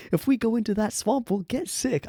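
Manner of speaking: high-pitched New York complaint; New York accent. Acted. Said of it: "I apologize to anybody from New York".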